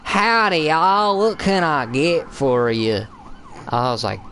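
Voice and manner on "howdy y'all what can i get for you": high, drawn-out Southern waitress greeting; exaggerated Georgia drawl, pitched up high; Georgia waitress accent. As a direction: imitate a Georgia waitress, slow and lilting. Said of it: "The Georgia accent that the waitress had".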